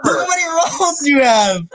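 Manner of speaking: high-pitched astonished exclamation; real giddy amazement; amazed disbelief. Real, not acted. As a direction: marvel at it, voice riding up high. Amazed at someone's many roles.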